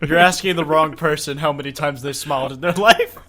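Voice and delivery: loud words through laughter; real laughing. Real, not acted. Co-host teasing a friend about rarely smiling, laughing. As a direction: keep talking while cracking up. Cracking up, repeating friend's answer.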